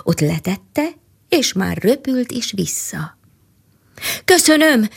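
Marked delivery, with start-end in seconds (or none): 4.2-4.9 higher, louder call after it; shouted thanks. A narrator's reading. King shouts thanks after the flying bird, higher and louder. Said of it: "Kiáltott utána a madarak királya".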